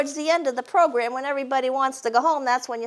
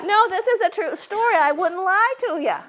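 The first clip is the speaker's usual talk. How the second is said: laughing protest, higher; mock-earnest while laughing; 7 semitones higher than usual. Shown as a demonstration. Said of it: "we always say that too".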